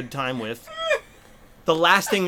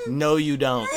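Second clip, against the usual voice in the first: normal talking voice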